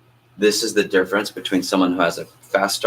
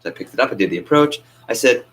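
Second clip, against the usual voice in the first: ordinary delivery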